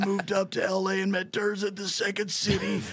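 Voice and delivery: high, strained voice shot from yelling; hoarse Super Dave voice. Retold or imitated. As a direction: sound like you screamed all night. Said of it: "I sounded like Super Dave".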